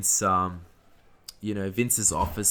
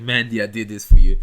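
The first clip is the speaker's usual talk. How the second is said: louder, put-on voice of a smitten wrestler; lovestruck Otis voice; 11 dB louder than usual. Fan voicing Otis dedicating his win to Mandy, loud and lovesick. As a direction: belt it out like a proud, lovesick big guy. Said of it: "he's like".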